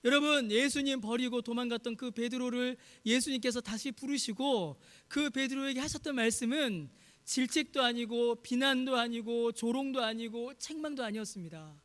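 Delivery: ordinary delivery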